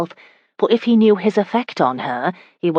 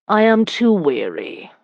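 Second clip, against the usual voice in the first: slow, drawn-out complaint, pitched up; weary; 4 semitones higher than usual. Acted. Husband claims to be tired, slow and weary. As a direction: draw it out as if worn out. Husband feigns weariness to wife.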